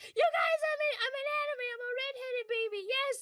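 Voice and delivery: bursting to share the news; giddy announcement. Retold or imitated. She tells everyone she's in an anime, high giddy squeal.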